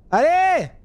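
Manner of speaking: high, loud exasperated yell; impatient shout. Real, not acted. Driver stuck behind a car, impatient shout. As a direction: hurry the car ahead. Urging car ahead to move.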